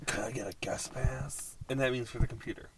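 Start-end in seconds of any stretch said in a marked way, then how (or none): 0.1-1.6 whispered quote. Retold or imitated. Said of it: "she's like".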